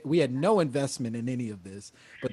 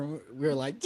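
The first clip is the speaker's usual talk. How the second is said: usual speaking voice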